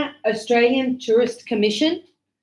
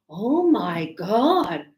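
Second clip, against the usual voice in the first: very slow, stretched-out swoon of astonishment; drawn-out exclamation; much slower than usual. Acted. Teacher acting out students' exclamations, drawn out. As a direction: drag every word out with swooping pitch. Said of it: "Or when you go".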